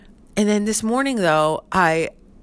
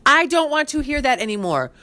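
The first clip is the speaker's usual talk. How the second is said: higher, exasperated telling-off; fed-up wife telling husband to stop, higher; mildly pissed; 7 semitones higher than usual. Retold or imitated. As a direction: snap it at him, fed up. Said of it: "'cause I get so pissed".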